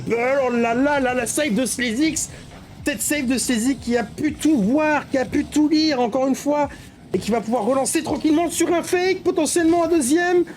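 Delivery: rising excited play-by-play. Real, not acted. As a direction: hype up the big save. Excited save call.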